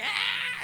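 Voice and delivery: a high squeal of pain, mock; old woman's shriek. Old lady shrieking at losing rock paper scissors, high squeal. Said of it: "and she's like".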